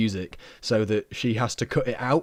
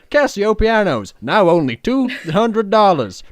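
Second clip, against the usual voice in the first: advert voiceover; 6 semitones higher, 7 dB louder than usual. Pretend. Mock advert read.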